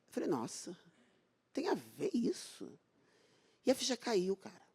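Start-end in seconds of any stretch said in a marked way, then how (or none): 0.3-2.7 drops almost to a murmur, slow; quiet dawning disbelief; slow hushed astonishment; stunned hush. Retold or imitated. Retold surprised realization.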